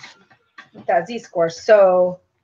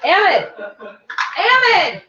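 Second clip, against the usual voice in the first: calling a name across the house, rising higher each time; 3 semitones higher than usual. Real, not acted. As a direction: call him from another room, getting more insistent. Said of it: "I'm not yelling across the house".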